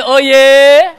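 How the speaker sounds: loud, high mock-macho "Oh, yeah"; guys' cool response. Shown as a demonstration. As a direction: ham up the boys' swagger. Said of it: "All the guys say".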